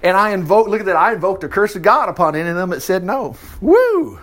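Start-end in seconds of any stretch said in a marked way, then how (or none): 3.6-4.2 high excited whoop. Real, not acted. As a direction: cheer it out. Preacher whoops at the curse.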